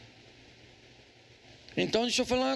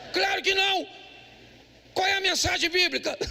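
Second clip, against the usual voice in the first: raised, higher preaching voice, driving each question home; preaching at full force; 5 semitones higher than usual. Acted.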